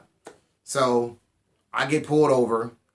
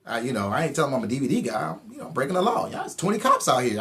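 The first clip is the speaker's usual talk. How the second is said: ordinary delivery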